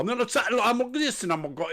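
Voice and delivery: high, mocking British accent; a mock British-Australian accent; fake British accent. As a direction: send up a put-on British accent. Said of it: "they talk like this".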